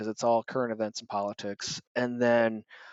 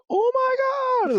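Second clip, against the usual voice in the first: high, slow squealing coo; 6 dB louder, much slower than usual. Acted. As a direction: squeal over a cute baby fox. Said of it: "cooing over this little fox".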